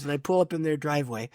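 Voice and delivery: usual speaking voice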